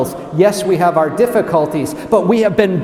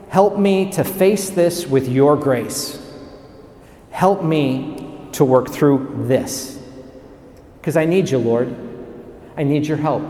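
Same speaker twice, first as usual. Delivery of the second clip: humble prayer; 4 semitones lower, 3 dB quieter than usual. Acted. Preacher modelling a prayer for help, quiet and humble. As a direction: let the plea settle lower and softer.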